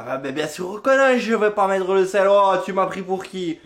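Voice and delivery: high, loud indignant retort; mock-offended high loud protest; indignant girlfriend. Voicing girlfriend quoted.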